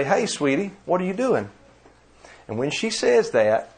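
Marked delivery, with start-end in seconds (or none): 0.0-1.5 higher, sing-song imitation of his wife's greeting; imitating sweet wife. Husband imitates wife's sweet phone greeting, higher and lilting. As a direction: play the doting wife on the phone. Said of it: "she'll say".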